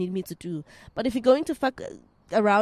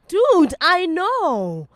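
slow drawn-out dude; emphatic; 7 semitones higher, 8 dB louder, much slower than usual. Real, not acted. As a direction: lean into the dude, loud.

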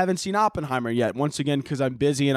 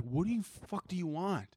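quiet, muffled exasperation at a pet; softly exasperated; 11 dB quieter than usual. Retold or imitated. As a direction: grumble it under the breath, fed up. Retold exasperation at whining dog.

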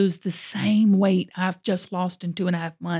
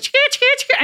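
high, rattled-off camera clicks; imitated camera shutter; much faster than usual. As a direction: mimic a camera snapping rapidly.

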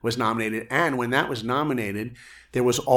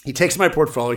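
ordinary talk, nothing marked

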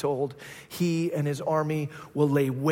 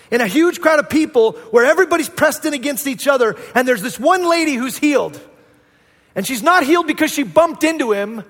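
loud, high impassioned preaching; a preacher's rising passionate climax; full-force preaching; 8 semitones higher, 10 dB louder than usual. Acted. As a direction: drive it home to the congregation.

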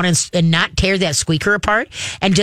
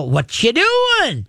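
high, very slow what you doing; curious dog voice; 7 semitones higher, much slower than usual. Retold or imitated. Dog trotting up to its owner, high slow curious voice. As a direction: drawl it out like a puzzled pup. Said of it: "the dog will come in and go".

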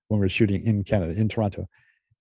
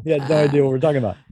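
ordinary talk

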